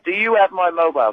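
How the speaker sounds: ordinary talk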